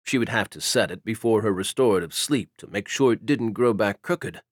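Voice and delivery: usual speaking voice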